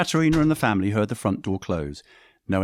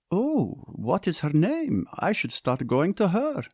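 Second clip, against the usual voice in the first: higher, slow and coy; mother teasing her daughter, coy and higher; 9 semitones higher, slower than usual. Acted. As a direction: play innocent, slowly. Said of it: "Erie said in a coy voice".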